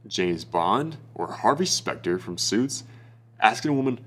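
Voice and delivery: usual speaking voice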